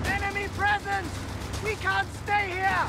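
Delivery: urgent soldier's warning shout; alarmed battle shout. Acted. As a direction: shout a warning to the squad under attack. Soldier warns of enemy contact.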